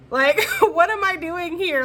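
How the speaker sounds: high, laughing and slowed, loud; laughing disbelief. Acted. Reader voicing a bored unicorn, laughing. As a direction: laugh out the question like a fed-up unicorn.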